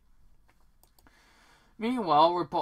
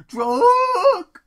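loud, high-pitched braying donkey; donkey impersonation; 16 dB louder than usual. Acted. Narrator imitating how he says the word drunk, donkey-like bray. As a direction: bray it out like a bad donkey impression. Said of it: "sounds like a donkey".

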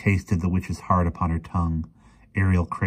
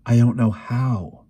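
slow, higher, helpless protest; distressed faltering; 5 semitones higher, 5 dB louder, slower than usual. A narrator's reading. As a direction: draw it out, overwhelmed and louder. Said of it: "she, she stammered".

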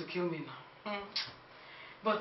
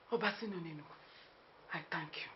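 ordinary talk, nothing marked

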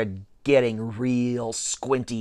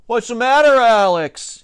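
high, loud taunt; taunting shout; 7 semitones higher, 5 dB louder than usual. A narrator's reading. Pitcher needling his rival Alex, shouting. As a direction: shout it across the field. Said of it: "he shouted".

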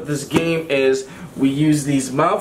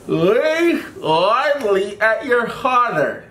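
slow, lips stretched wide; mouth guard in; 4 semitones higher, much slower than usual. Real, not acted. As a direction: slowly force words past a mouthguard.

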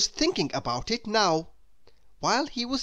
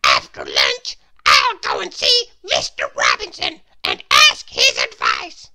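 tiny character voice, pushed louder; Mister Small's voice; 14 semitones lower, 11 dB louder than usual. Acted. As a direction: play the little man making a plan.

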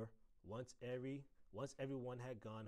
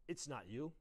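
ordinary delivery, nothing marked

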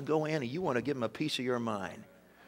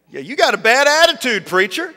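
loud, high-pitched needling; hammed-up accusing jab; mock challenge; 7 semitones higher, 7 dB louder than usual. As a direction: call him out, voice pushed up. Retold challenge to the pastor.

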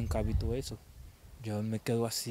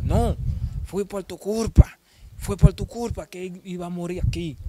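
angry woman yelling; 6 semitones higher, 8 dB louder than usual. Retold or imitated. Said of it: "la mujer hablándome duro".

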